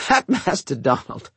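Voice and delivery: chuckling through the words; laughing. Acted. Cook joking with the boy, laughing. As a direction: laugh as you name him. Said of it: "She broke up laughing".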